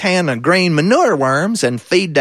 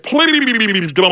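talking catfish voice; 3 semitones higher than usual. Acted. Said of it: "that fish spoke to me and said".

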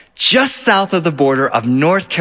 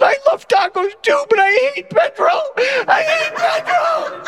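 put-on crying kid. Brother crying to family about Pedro, high sobbing wail. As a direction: wail it through tears like a betrayed child. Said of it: "Robert said".

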